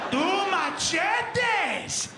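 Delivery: high, slow, loud proclaiming; hammed-up heroic shout; triumphant shout. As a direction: announce the blades like a battle cry. Reveals weapons triumphantly.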